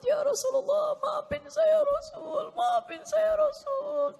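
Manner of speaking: high, drawn-out tearful wail; acted sobbing. Man weeping at the Prophet's tomb, high pleading wail. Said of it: "Nangis terus di hadapan makam".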